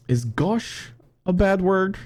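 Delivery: put-on little girl voice. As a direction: ask sweetly like a small girl. Daughter voiced, high and slow.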